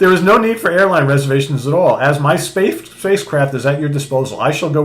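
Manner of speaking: high, loud, formal offer; Moondragon. Retold or imitated. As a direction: proclaim it formally, easing down toward the end. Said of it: "And then Moondragon walks in".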